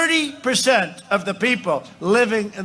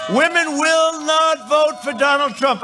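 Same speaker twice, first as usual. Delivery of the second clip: much higher-pitched mock pundit voice; 8 semitones higher than usual. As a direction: mimic a know-it-all's emphatic prediction. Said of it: "they're great geniuses".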